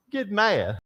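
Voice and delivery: usual speaking voice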